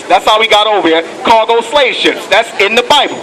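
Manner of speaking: ordinary delivery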